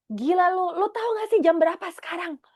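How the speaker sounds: mock-annoyed, rushed telling-off; angry retort. Woken up and annoyed, she snaps at Louis at the gate, fast and irritated. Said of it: "aku tuh marah banget".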